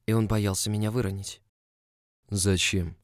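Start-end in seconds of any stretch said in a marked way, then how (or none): none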